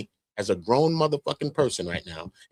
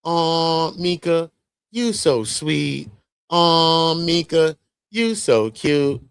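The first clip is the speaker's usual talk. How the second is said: drawn-out, higher, mock sweet-talk to Mika; 5 semitones higher, 4 dB louder, much slower than usual. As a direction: coo the words slowly like a sugary flirt. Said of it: "She gonna say out her lips".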